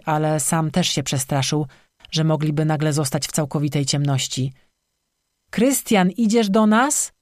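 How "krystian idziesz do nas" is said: high, slow call into the dark. Acted. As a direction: call out and wait for an answer. Calling out into dark forest.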